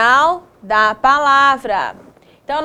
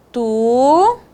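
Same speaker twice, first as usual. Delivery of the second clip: "Tucano" as long "Tu", "ca", "no" with long pauses; syllable by syllable. Shown as a demonstration. Teacher writing for pupils, "Tucano" in fully separated syllables. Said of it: "Vou escrever aqui".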